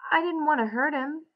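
high-pitched distressed apology; crying out. A narrator's reading. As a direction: plead high, like an upset Lenny. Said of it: "Lenny cried".

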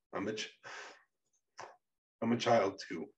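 halting, mildly tearful; sobbed. A narrator's reading. Payne confesses to Becca, haltingly, mildly sobbing. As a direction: break off, then restart with a hint of a sob. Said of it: "before he sobbed".

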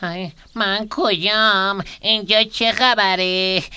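slow, groggy, starting soft; dazed waking. Acted. Hurt character wakes in a strange place, dazed. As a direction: come round groaning and confused. Coming to, not knowing where.

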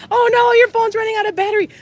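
high, loud and fast alarm; quick startled oh no; surprised. Real, not acted. Alarmed by viewer's low battery.

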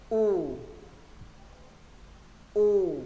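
long U with lips in a tube; drawn-out, tightly rounded U. Shown as a demonstration. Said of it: "Môi hình ống nâng cuống lưỡi".

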